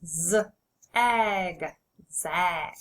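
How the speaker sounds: teacher segments the sounds, then blends; sounding out and blending. Shown as a demonstration. Teacher modelling sounding out for the class, sounds split then blended. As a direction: stretch each sound apart, then blend the word. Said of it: "Say the sound with me and then blend".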